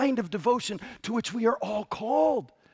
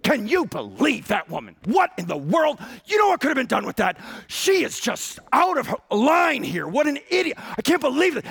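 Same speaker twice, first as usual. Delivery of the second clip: mock indignant growl, louder and higher; growling critics; 5 semitones higher, 3 dB louder than usual. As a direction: sputter like an offended bystander. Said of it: "the word here is growl".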